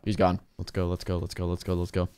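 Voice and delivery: whispered, soft let's go; hushed urging. Real, not acted. Gamer sneaking into the house, whispering to himself. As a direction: keep it down while you sneak.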